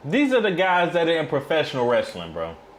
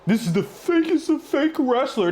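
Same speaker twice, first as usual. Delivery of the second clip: mocking the promo; 9 semitones higher than usual. Streamer mocking a wrestler's promo, high whiny imitation. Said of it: "come on here and just say".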